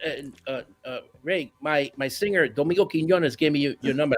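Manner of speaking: a hint of a shy, soft-spoken bandleader. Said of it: "He goes".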